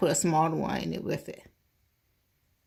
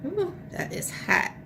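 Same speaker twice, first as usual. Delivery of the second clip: hot food gasp; 11 semitones higher than usual. Real, not acted. Tasting food straight from the fryer.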